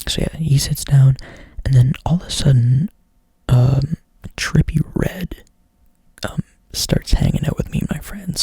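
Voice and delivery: ordinary talk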